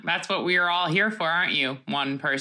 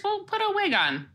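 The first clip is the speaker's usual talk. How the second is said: high teasing; 13 semitones higher than usual. Real, not acted.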